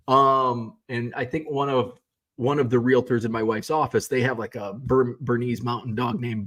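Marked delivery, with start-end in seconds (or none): none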